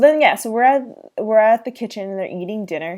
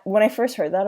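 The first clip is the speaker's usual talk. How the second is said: ordinary talk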